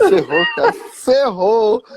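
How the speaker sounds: high, loud cry of dismay; mock panic. Goalkeeper realises the heckling has resumed, loud mock panic. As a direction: act out hammed-up despair. Acting out his dismay at the taunt.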